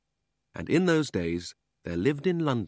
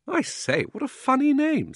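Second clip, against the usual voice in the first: put-on high, loud girlish exclamation; amused astonishment; 6 dB louder than usual. Polly hears the boy's odd name, high astonished exclamation. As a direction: exclaim it high, swelling on the end.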